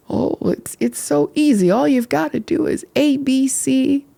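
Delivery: mock breezy advice. Mocking easy grief advice.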